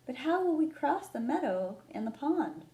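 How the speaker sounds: Cat fretting over the crossing; Cat asking the others, worried. Acted.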